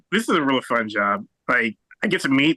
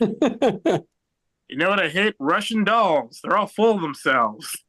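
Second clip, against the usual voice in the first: open-mic joke tryout; 6 semitones higher, slower than usual. Acted. Acting an open-mic comic testing jokes.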